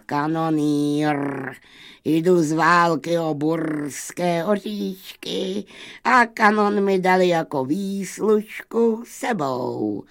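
put-on high gunner voice, long drawn vowels; disguised voice. A narrator's reading. Ram posing as a gunner at the wolves' door, disguised voice. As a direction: disguise the voice as a boastful cannoneer. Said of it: "Schválně měnil hlas beránek".

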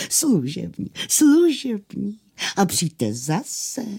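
slow, high, fawning tailor; bow and scrape with the voice; deferential. Acted. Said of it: "úctivě je otevřel a stále opakoval".

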